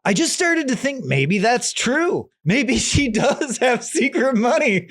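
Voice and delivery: high-pitched, drawn-out wondering with a laugh; mock self-convincing. Husband believing his own lie in his head, mock wondering. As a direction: talk yourself into it, high and amused. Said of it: "in his head, a very fascinating turn".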